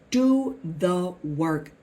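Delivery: very slow, deliberate command; slow emphatic urging. Real, not acted. Coach urging the audience to act, slow and emphatic. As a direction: draw out each word for weight. Drives home advice to audience.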